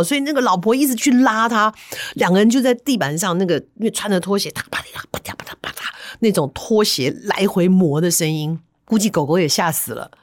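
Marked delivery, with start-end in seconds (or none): 4.5-5.9 quiet, breathy scraping noises; soft shuffling sounds; whispered slipper shuffle. Retold or imitated. Imitating slippers scraping floor.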